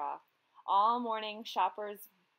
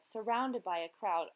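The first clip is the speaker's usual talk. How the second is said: usual speaking voice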